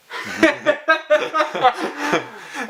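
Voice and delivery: long high falling laugh. Real, not acted. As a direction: let a big laugh fall away. Laughs at own story.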